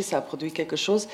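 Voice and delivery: ordinary delivery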